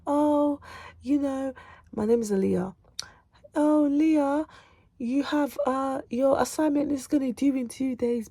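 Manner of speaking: high, sing-song mock teacher reminder; imitating a teacher. Mocking how a teacher reminds students of deadlines, high mock teacher voice. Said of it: "no one is gonna remind you of".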